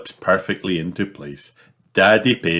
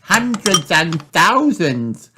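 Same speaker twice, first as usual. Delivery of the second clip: high, slow delighted exclamation; excited cry; 12 semitones higher, 4 dB louder, slower than usual. Acted. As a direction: call it out in delight. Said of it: "cries Peppa".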